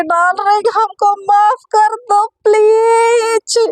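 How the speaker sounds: high, slow, drawn-out begging darling; wheedling plea. Acted. Satya begs his fiancée for mercy, slow high wheedling plea. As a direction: stretch each word out, pleading sweetly.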